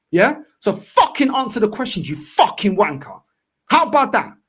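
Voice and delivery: furious demand. Real, not acted. Fan ordering the manager to answer the press, furious.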